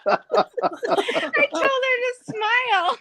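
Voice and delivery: very high, slow, squeezed out through laughter; laughing. Real, not acted. Dealer recalling what she told a player, laughing hard. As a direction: say it while cracking up. Laughing at her own story.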